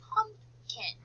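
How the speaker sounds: teacher's careful PUMP-KIN with a gap between; syllable by syllable. Shown as a demonstration. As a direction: repeat PUMPKIN slowly in two parts. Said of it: "there are two syllables".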